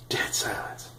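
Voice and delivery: breathy hush for the awkward silence; hushed, unvoiced echo of the silence; dramatic whisper. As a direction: whisper it like the room froze. Echoing the awkward moment.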